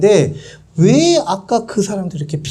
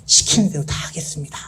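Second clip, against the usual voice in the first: soft, low, quick servile promise; fawning toady; 4 semitones lower, 6 dB quieter, faster than usual. Acted. Tax collector sucking up to Romans, fawning quietly. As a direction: suck up under your breath. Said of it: "막 싸바싸바를 한 거야".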